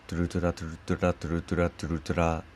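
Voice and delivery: トゥルトゥラ repeated fast, flicking the tongue tip on each ル and ラ; rolled r drill. Shown as a demonstration. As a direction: rattle off トゥルトゥラトゥルトゥラ quickly with a tapped r. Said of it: "やり方としては".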